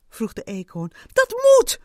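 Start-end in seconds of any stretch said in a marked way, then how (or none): 1.1-1.8 high, loud yell; screaming. A narrator's reading. Ant screaming at the squirrel, furious insistence. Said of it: "schreeuwde de mier".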